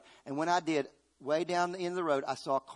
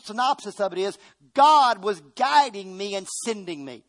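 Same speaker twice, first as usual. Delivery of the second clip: preaching at full force; 6 semitones higher, 7 dB louder than usual. Acted. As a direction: hammer each question out to the church. Preacher lists Joseph's trials.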